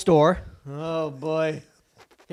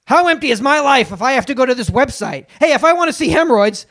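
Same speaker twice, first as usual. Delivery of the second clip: Jay Leno impression. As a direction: rant like an aging late-night host doing stand-up. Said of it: "Do Leno".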